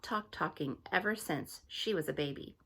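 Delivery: ordinary delivery, nothing marked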